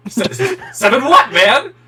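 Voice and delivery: incredulous half-laugh at the waiter; baffled laughing disbelief. Retold or imitated. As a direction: laugh out a stunned question.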